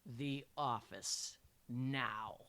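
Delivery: low, slow, restrained anger; through gritted teeth. A narrator's reading. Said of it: "I said through gritted teeth".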